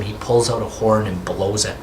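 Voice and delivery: normal talking voice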